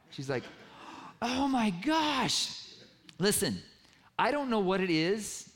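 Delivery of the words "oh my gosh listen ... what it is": high, slow, stretched-out gasp of disbelief; freaking out. Retold or imitated. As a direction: imitate her stunned, drawn-out joy. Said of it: "She called me freaking out".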